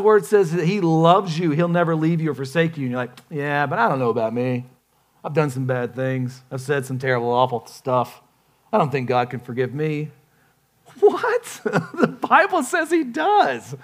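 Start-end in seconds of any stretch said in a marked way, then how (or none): 3.3-10.1 low, subdued confession; downcast self-doubt. Acted. Preacher voicing a listener who doubts forgiveness, downcast. 11.0-13.8 very high-pitched, laughing disbelief; incredulous. Acted. Preacher answering the doubter's claim, incredulous.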